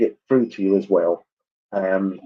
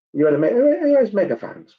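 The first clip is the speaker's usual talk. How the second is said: surprised disbelief; 11 semitones higher than usual. Real, not acted. Surprised at the megaphone detail.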